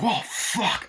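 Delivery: high mock alarm; acted shock. Crew watching the man transform, acted shock. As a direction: exclaim as if witnessing something horrific.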